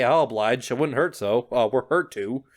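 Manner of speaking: ordinary delivery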